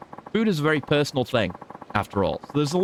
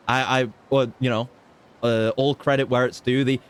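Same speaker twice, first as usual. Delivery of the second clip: normal talking voice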